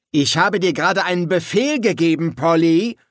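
high-pitched, forceful reprimand; insistent command. A narrator's reading. Maledikt insists on his order.

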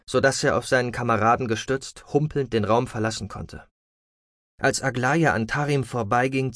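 ordinary delivery, nothing marked

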